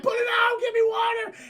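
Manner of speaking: very high, loud mock panic; panicked shout. Burning citizens begging for water, panicked yelling. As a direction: shriek for help, hammed up.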